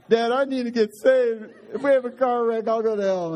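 put-on high, loud little-girl plea to her dad; frightened little girl. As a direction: play a scared child begging her father.

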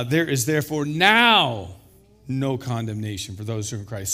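a big, stretched NOW; stress on NOW. Shown as a demonstration. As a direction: hit "now" long and hard, then ease off. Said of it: "Let's emphasize the word now".

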